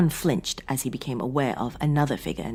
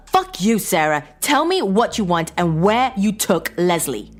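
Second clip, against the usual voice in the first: loud, high angry cut-off; furious interruption; 7 semitones higher, 7 dB louder than usual. A narrator's reading. John to his wife, loud furious interruption. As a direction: shout her down. John cuts her off angrily.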